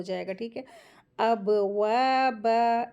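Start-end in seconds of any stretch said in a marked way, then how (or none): 1.2-2.9 अबवाबा with bouncing b and long vowels; qalqala and madd. Shown as a demonstration. Teacher models qalqala, bounced b in slow अबवाबा. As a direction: bounce the first b of अबवाबा, stretch waa and baa. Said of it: "इस तरीके से".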